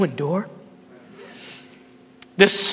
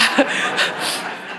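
soft airy chuckle; breathy laugh; 4 dB quieter than usual. Real, not acted. Laughs at own joke.

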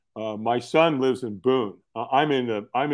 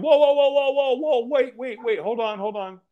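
high, hurried call to stop; high whoa, calling someone back; urgent backpedal; 10 semitones higher than usual. Retold or imitated. Doctor Bob calls him back.

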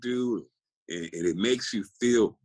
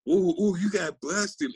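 higher, taunting singsong of the doubters; mocking teasers; 10 semitones higher than usual. Lifter mimics critics saying he got busted, in a higher taunting voice. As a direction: tease him like a smug know-it-all. Mimicking people teasing him.

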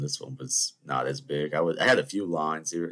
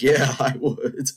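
drawn-out, higher reply breaking into laughter; laughing admission; 8 semitones higher, 6 dB louder, much slower than usual. Retold or imitated. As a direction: own up cheekily through a laugh. Laughs owning up to teasing.

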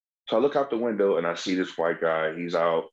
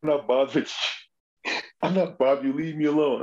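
higher, pushy mock drunk snarl; belligerent drunk; 5 semitones higher than usual. Drunk guy on the stairs telling him off, mock belligerent. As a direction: play the hostile drunk lightly. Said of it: "he's immediately belligerent".

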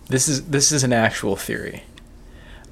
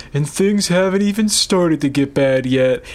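quoting Doug. Retold or imitated. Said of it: "Doug says".

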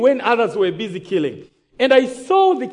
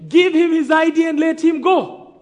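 high, loud retold command; commanding shout, acting out the chief; shouted order; 5 semitones higher, 4 dB louder than usual. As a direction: shout the order across to the men. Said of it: "And he shouts".

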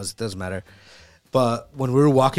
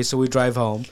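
plain reading voice

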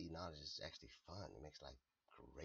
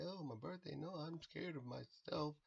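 higher, slow, hammed-up frightened whine; mock scared; 10 semitones higher, slower than usual. He mocks people who fear their birthday reading, in a hammed-up scared voice. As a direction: parody someone afraid of their own birthday. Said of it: "afraid to be like".

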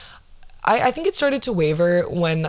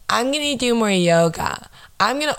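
higher-pitched mock resolution-maker; mocking imitation; 6 semitones higher than usual. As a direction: imitate someone proudly announcing a resolution, pitched up. Mocks people announcing their resolutions.

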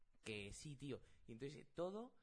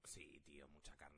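hushed; 9 semitones lower, 11 dB quieter, slower than usual. Real, not acted. Agreeing about good meat.

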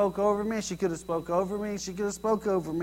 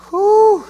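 high, loud "whew"; mock startled whoop; 13 semitones higher, 13 dB louder than usual. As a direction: let out a hammed-up whoop of fright. Acting out being scared.

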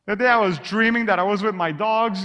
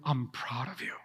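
soft whisper, much softer and lower; whispered father's voice; 8 semitones lower, 12 dB quieter than usual. Acted. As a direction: breathe it like a father leaning close. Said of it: "the voice of a father tell you".

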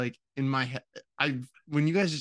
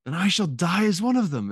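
higher, louder imitation of a film hero; movie quote from memory; 6 semitones higher, 5 dB louder than usual. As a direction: quote the heroic line with mock gravity. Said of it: "I kept hearing that quote".

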